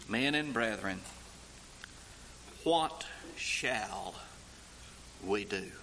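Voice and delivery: low, soft plea of "Men and brethren, what shall we do?"; hushed plea. Acted. Crowd asking Peter what to do, hushed and stricken. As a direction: murmur the question low and stricken.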